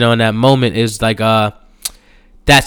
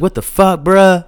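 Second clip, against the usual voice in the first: lightly put-on street talk, pitched higher; Miles; 8 semitones higher than usual. Miles in the party kitchen, brash slang. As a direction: mimic a brash friend's slangy complaint. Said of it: "you know, talking like that".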